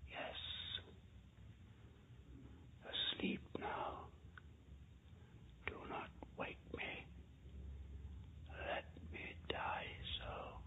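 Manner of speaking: faint, slow breathless whisper from a dying man; barely audible whisper. A narrator's reading. Dying Valdemar answers the mesmerist, barely audible whisper. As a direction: breathe the words out slowly, almost no voice. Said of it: "in a barely audible whisper".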